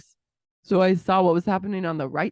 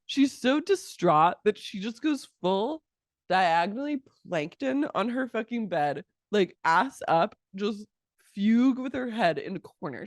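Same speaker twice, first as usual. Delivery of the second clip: ordinary delivery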